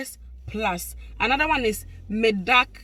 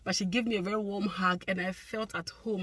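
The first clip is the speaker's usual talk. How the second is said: ordinary delivery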